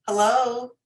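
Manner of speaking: composed phone greeting at an easy pitch. Shown as a demonstration. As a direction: answer the phone with your tone kept down. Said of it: "big difference in your tone of voice".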